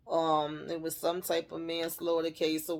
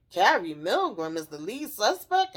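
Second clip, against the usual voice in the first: retold disbelief with swooping pitch peaks; incredulous question; 3 semitones higher, 4 dB louder than usual. Recapper voices Meth learning of the suspect, incredulous. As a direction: question it in disbelief, pitch leaping on key words.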